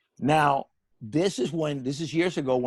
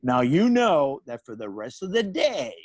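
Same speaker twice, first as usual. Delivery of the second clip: normal talking voice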